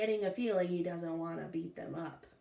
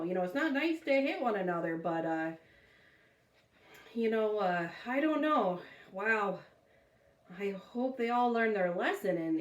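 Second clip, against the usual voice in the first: ordinary delivery, nothing marked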